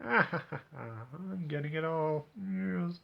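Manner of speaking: slow, drawn-out villainous gloating after a chuckle; hammed-up scheming smugness, kept soft; quiet smirking gloat. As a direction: chuckle softly, then savour each word. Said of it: "just quietly smirking in the corner going".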